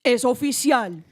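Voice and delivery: put-on grave Colombian-accented announcement with weighty pauses. Guest announcing a soccer signing on request, mock-solemn Colombian accent. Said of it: "would you mind reading in a Colombian accent".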